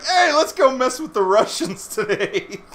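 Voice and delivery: mocking the soldiers as bored jokers; goofy soldiers. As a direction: play the soldiers as gleeful pranksters. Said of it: "these Americans were just kinda like".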